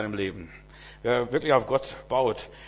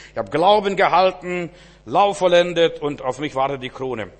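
higher, louder, full-force sermon voice; fervent preaching; 4 semitones higher, 8 dB louder than usual. Acted. Preacher telling David's story, loud fervent preaching. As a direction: preach it out with fire.

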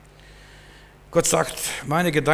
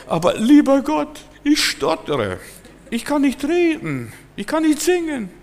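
reluctant Moses; 12 semitones higher than usual. Retold or imitated. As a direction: whine your excuses up to God. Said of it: "er gab Gott nicht recht".

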